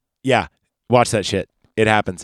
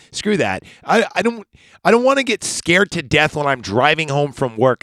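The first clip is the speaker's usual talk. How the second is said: ordinary delivery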